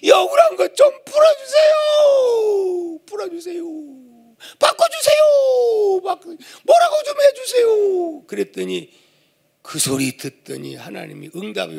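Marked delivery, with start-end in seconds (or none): 0.0-3.0 high tearful shouting plea; wailing complaint. Retold or imitated. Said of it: "열받아가지고". 3.1-3.6 faded plea. Retold or imitated. Repeated plea, dropped. 4.6-8.2 high tearful shouting plea; wailing complaint. Retold or imitated. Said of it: "결국은 열받아가지고 하나님 앞에".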